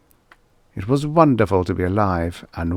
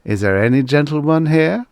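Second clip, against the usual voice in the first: higher, louder pushy sales patter; robot salesman pitch; 7 semitones higher, 6 dB louder than usual. Acted. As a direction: sell it like a showman robot.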